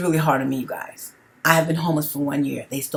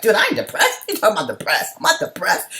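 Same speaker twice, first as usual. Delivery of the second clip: indignant denial; 5 semitones higher than usual. Retold or imitated. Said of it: "I was like".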